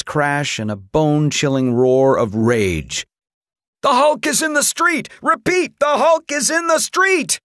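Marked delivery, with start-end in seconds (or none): 3.8-7.4 panicked cry. A narrator's reading. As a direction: cry the alarm into the radio. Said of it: "the soldier cried into the radio".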